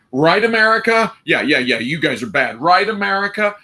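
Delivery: high, pleading mock plea for approval; mock needy appeal. Europe glancing back at America, mock-pleading for support. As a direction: ask America for backup, cap in hand, pitched high. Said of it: "looking over the shoulder saying".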